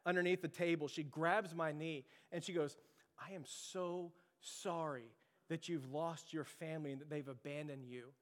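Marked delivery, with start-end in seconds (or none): 3.2-8.1 slow, softened imitation of heartfelt condolence; sincere sympathy. Wife consoling a man who lost his family, sincere sympathy. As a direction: console gently, letting the words sink in.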